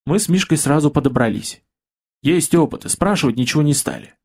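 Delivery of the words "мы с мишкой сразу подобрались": ordinary delivery, nothing marked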